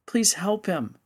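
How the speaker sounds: put-on high, slow, tearful child's plea; sobbing plea. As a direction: beg through sobs like a little girl. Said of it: "she sobbed".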